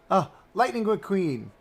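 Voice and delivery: high, gasping excitement; gasp, then cry out the name; excited recognition. Real, not acted. Streamer spots a beloved car character, gasping excitement.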